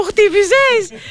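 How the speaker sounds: high-pitched retold cry to her brother; acted exclamation. Acted retelling of laughing sister.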